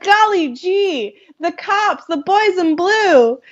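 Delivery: high, slow hammed-up boy voice; hammed-up golly gee kid. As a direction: ham up a wholesome kid. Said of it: "totally hamming it up".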